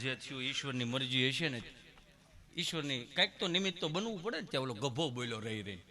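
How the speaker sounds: ordinary delivery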